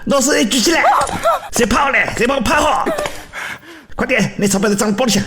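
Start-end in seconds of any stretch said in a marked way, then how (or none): 0.0-2.6 shouted command. Acted. Robber calling out hands up.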